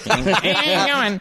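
very high goofy voice; impression of Marty. Mimicking a rival Batman's greeting, high-pitched impression. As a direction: squeak it like an oddball Batman impersonator. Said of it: "He literally talked like that".

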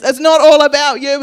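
loud telling-off, rising on "all"; parent telling off. Retold or imitated. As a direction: scold the kid, hitting "all". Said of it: "you've probably said it to your kids".

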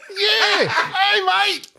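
high, laughing Aussie voice; a hammy Aussie hey mate; bad Australian accent. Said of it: "making a really bad Australian accent".

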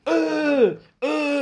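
high, loud training grunts; martial arts kiai grunts. Retold or imitated. Mimicking the grunts of the eye-attack training, high and loud. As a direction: grunt it out like a drill strike.

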